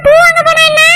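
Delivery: ordinary delivery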